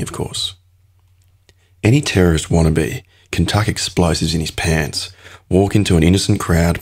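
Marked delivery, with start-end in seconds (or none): 0.0-0.5 dry whispered afterthought; whispered aside. A narrator's reading. Sly narrator aside.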